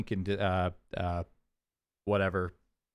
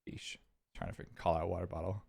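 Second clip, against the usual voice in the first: ordinary talk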